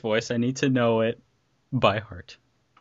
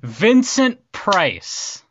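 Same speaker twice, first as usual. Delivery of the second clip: slow and drawn out; 6 semitones higher, 5 dB louder, much slower than usual. Real, not acted. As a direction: stretch each syllable slowly. Savoring the name.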